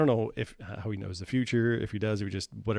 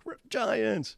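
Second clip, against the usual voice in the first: high, far-off squeal of discovery; excited giants; 13 semitones higher than usual. Retold or imitated. Two friends finding giants online, high excited squeal. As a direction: squeal it like a wild find. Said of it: "you and I were like".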